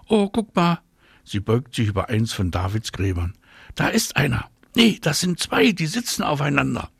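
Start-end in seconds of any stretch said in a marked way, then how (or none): 0.1-0.8 high-pitched excited little exclamation; much higher, eager child's call; excited discovery. A narrator's reading. Girl spots ladybugs, excited. 3.8-6.9 put-on high, eager child's voice; excited high-pitched little observer; excited discovery. A narrator's reading. Girl discovers two ladybugs.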